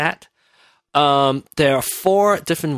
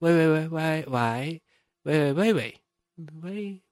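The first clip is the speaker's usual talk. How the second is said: soft wei chain; 4 semitones higher, 5 dB quieter than usual. Shown as a demonstration. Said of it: "Do you say".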